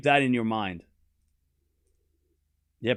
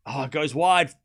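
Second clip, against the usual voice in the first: normal talking voice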